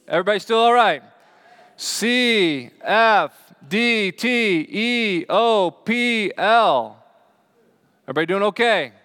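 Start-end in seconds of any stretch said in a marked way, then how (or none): 1.8-7.0 slow, raised, drawn-out letters; calling out letters. Shown as a demonstration. Preacher testing the congregation's eyes on a chart, calling out slowly. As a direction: call each letter out separately, high and long.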